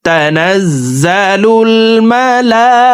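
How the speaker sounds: slow, high, drawn-out chant; Quran recitation. Acted. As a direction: sing the phrase like a reciter. Chants the joined phrase.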